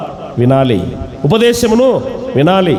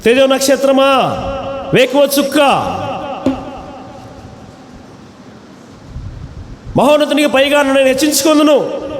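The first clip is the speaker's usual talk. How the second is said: higher, proud self-exalting boast; boastful Satan; 6 semitones higher than usual. Retold or imitated. Preacher voicing Satan's boast to the congregation, higher and proud. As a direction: crow it like a devil lifting himself above God. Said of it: "గర్వించాడు సాతానుడు".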